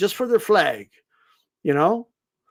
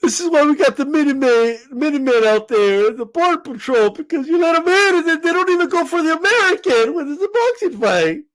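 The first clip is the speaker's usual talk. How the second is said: higher, whiny rant about the border; mock patriot rant; 7 semitones higher than usual. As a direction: keep the hammed-up patriot going.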